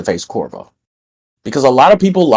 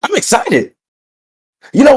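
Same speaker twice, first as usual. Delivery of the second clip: "I'm excited" said much higher and brighter; 13 semitones higher than usual. Acted. Wrestler tells the show he's hyped, pitched high. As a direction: throw "I'm excited" up high like real hype. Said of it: "If you don't hear the excitement in my voice".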